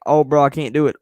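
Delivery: loud, mocking last-second cancel; mock excuse voice. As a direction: sneer out the lame excuse. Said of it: "I get more mad when somebody's like".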